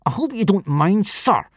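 high, tight mock politeness; spitting resentment. A narrator's reading. Bully answering back to his teacher, spitting it. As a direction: spit the words out resentfully. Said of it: "spat Snotlout".

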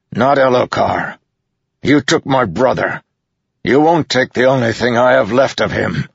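high, impassioned defiant shout; bellowing. A narrator's reading. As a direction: bellow it in defence of family. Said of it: "Dalinar bellowed".

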